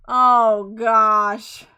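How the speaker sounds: long, loud drawn-out groan; loud exasperation. Real, not acted. Player despairing over a hated character, loud exasperated groan. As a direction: groan the words out loudly and slowly.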